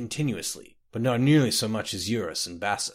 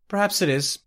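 shrugging; 8 semitones higher, 4 dB louder than usual. A narrator's reading. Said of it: "shrugged Baseth".

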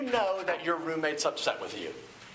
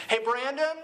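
higher, casual call off to the side; a lightly voiced suburban dad calling out; sketch character; 7 semitones higher than usual. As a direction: call to a kid while keeping it light. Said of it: "he says".

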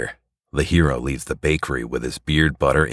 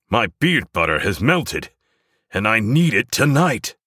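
frantic hero; 8 semitones higher than usual. Acted. Hero frantic about beard butter.

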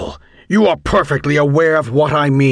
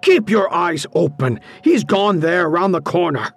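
higher-pitched, moderately shouted instruction; shouted order; 6 semitones higher than usual. A narrator's reading. Teacher directing the janitor after the intruder, mild shout. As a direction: call out directions with some urgency. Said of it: "Clark shouted at the janitor".

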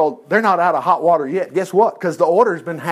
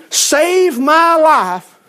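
high, drawn-out plea, stretched very slow; desperate plea to the king; pleading queen; 8 semitones higher, 4 dB louder, much slower than usual. Acted. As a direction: beg for your life, each word held long. Queen begs king for her life.

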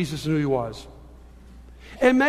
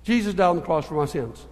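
lower, throwaway delivery as if it means nothing; offhand; 4 semitones lower, faster than usual. Retold or imitated. Churchgoers who grew up in Christianity reciting belief, offhandedly. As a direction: say it with no weight at all. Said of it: "We offhandedly say, like it means nothing".

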